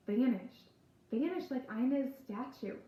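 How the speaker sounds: ordinary delivery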